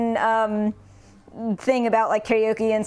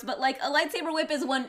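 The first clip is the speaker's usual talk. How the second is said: usual speaking voice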